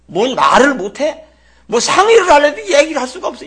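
loud, high mock complaint of grown children; children complaining. Children griping about their father, mock loud complaint. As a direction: whine loudly as a frustrated kid. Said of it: "그 아이들이 뭐라 그러겠어요".

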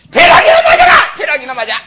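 very high screaming curse; cursing shout. Retold or imitated. Old granny cursing back at the insult, shrieking. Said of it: "개 장사 죽이는 할머니 같으면".

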